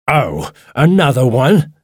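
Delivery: high, slow, angry disbelief; irritated man, drawing out the question with rising pitch. A narrator's reading. Angry man finding another child in his house, angrily. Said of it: "he said angrily".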